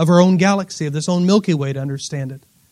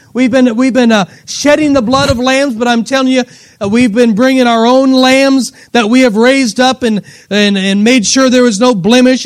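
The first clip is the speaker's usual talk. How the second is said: raised, driving sermon voice; impassioned preaching; 5 semitones higher, 6 dB louder than usual. Acted. Preacher telling of lambs brought year after year, impassioned preaching. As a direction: preach it hard, piling up the phrases.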